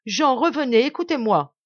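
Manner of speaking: loud, almost begging call into the dark; pleading shout. Acted. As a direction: call him back, nearly pleading. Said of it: "d'une voix presque suppliante". (appel presque suppliant)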